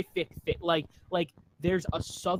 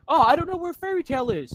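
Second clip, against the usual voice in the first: high, loud mock-clueless whine; sarcastic imitation of a clueless girl; 8 semitones higher, 8 dB louder than usual. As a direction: parody a dim character, much higher. Mocking a character's cluelessness.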